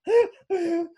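short high-pitched cackle; high laugh. Real, not acted. Laughs after denying the story.

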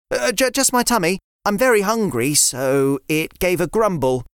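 put-on a sensible boy explaining gently; a hint of a child lecturing a friend; young boy River. River voiced, fibbing.

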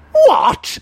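very high, loud yelp of disbelief; shocked exclamation. Acted. Learner hearing about an immediate fine, aghast. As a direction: burst out in high-pitched shock. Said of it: "I exclaimed".

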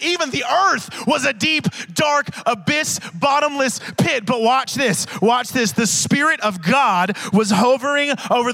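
loud, high full-force preaching. Acted. Preacher to congregation, at full force.

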